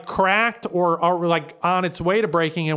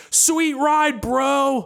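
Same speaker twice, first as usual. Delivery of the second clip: higher-pitched mock gushing passerby; passing admirer; 6 semitones higher, much slower than usual. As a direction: call out like an impressed car fan. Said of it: "every car that goes by, like".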